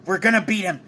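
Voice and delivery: ordinary delivery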